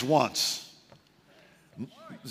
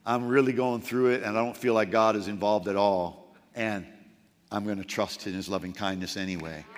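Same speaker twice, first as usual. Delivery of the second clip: much softer and lower, hushed devotion; quiet trusting prayer; 12 semitones lower, 9 dB quieter than usual. Acted. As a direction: pray it gently under your breath.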